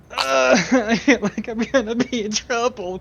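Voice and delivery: high, giggling panic; nervous laugh. Retold or imitated. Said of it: "kind of look on her face".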